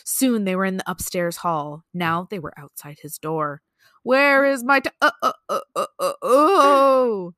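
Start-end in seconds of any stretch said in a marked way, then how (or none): 4.0-7.3 hammed-up haunting groan; groaning ghost. A narrator's reading. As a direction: stretch the wail into pulses, then one long swell. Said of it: "the voice groaned".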